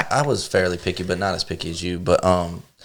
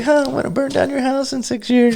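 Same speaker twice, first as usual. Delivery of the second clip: high squeaky vow of revenge; high mock kid voice. The tricked kid threatening the parents, high squeaky voice. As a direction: squeak out a petty kid's threat.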